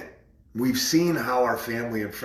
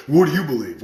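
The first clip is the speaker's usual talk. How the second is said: loud, higher-pitched challenge; insistent interrupting; 4 semitones higher, 7 dB louder, faster than usual. Retold or imitated. Friend interrupting to turn it back, insistent challenge. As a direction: cut in with a retold demand. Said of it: "he keeps interrupting".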